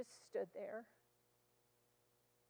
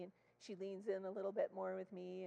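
ordinary delivery

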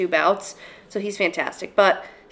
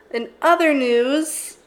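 drawn out, higher segue; slow, announcer-like segue; 6 semitones higher, 5 dB louder, much slower than usual. Acted. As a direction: stretch it like a newsreader. Topic change, drawn out.

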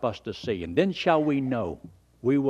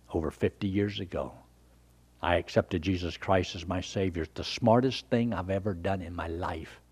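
ordinary talk

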